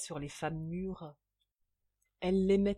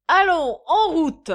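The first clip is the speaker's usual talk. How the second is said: high, loud rallying cry; clamouring shout; 11 semitones higher, 16 dB louder, slower than usual. A narrator's reading. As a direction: bellow the order to set off. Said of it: "clama J B". (clamé à pleine voix)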